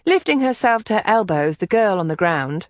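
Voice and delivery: usual speaking voice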